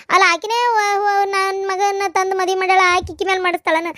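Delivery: ordinary delivery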